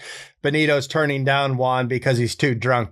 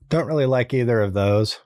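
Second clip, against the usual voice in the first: ordinary talk, nothing marked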